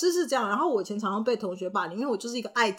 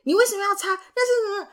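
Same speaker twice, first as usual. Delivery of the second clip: much higher accusing question; imitating a bossy classmate, higher; scolding classmate; 11 semitones higher, 4 dB louder, faster than usual.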